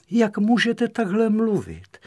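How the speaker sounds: high-pitched reproachful question; indignant, lightly acted rebuke; scolding. A narrator's reading. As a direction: chide him, voice pitched high. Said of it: "pokárala ho".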